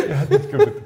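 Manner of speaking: high squeaky laughing echo; laughing falsetto. Retold or imitated. Echoes the line, laughing.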